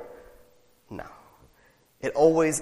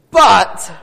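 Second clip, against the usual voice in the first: loud emphatic "But"; 3 semitones higher, 10 dB louder than usual. Acted. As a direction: throw the word But out like a call. Dramatic repeated But.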